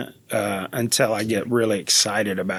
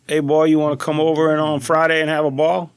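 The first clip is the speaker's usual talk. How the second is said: higher, louder South Louisiana Cajun holler; Cajun accent; 5 semitones higher, 4 dB louder than usual. Shown as a demonstration. Guest showing hosts how folks talk back home, Cajun accent. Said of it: "hit us with some of 'em".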